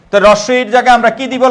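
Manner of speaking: usual speaking voice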